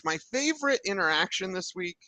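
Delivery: normal talking voice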